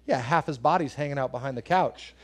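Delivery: ordinary talk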